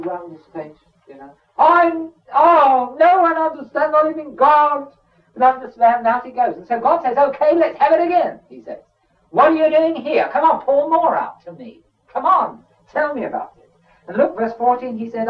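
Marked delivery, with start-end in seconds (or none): 1.6-6.1 high, loud self-pitying whine; sulking Elijah. Acted. Preacher playing Elijah sulking in the cave, loud high whine. 7.2-13.7 high, loud cajoling call; coaxing God. Acted. Preacher voicing God coaxing Elijah to speak, high and loud.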